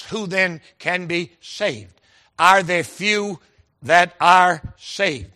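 very slow, word by word up to "saved"; spaced-out words, landing hard on "saved"; emphasis on saved. Retold or imitated. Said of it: "Every time I come to the word saved".

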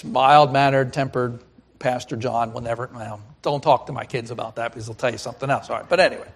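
ordinary talk, nothing marked

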